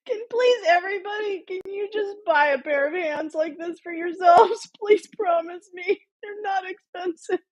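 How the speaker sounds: high, tearful plea through real laughter; weepy high giggling voice; laughing to tears. Real, not acted. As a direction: beg them while cracking up. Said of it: "I'm really crying".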